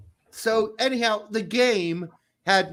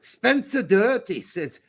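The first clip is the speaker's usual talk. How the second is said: ordinary talk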